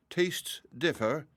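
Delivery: slow, drawn-out murmur. Acted. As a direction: let it out slowly, unbothered. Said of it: "murmured the dragonette".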